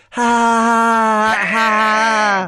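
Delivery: high mock "ha, ha"; forced laugh. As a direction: toss off a fake high laugh.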